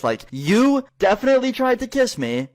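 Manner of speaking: high-pitched, insistent retelling; retold outraged callout; indignant accusation.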